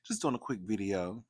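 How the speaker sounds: ordinary talk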